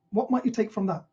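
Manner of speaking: ordinary talk, nothing marked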